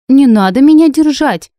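high, loud indignant shout. A narrator's reading. Heroine rebuffing those told to seize her, shouting. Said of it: "крикнула я".

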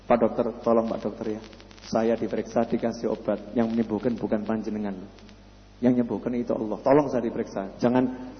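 low, soft-spoken patient pleading with a doctor; imagined patient. Retold or imitated. A patient tells the doctor he needs only Allah, low soft plea. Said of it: "Masa ada orang pergi ke Pak Doktor".

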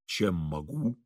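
low, soft bass voice; sudden bass. A narrator's reading. Engineer answering an unknown visitor, unexpected bass. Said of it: "неожиданным басом".

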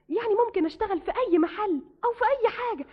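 ordinary delivery

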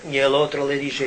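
ordinary talk, nothing marked

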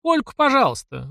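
high eager exclamation; exclaiming. A narrator's reading. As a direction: jump in eagerly before he changes his mind. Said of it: "воскликнула арфистка". (высокий радостный возглас)

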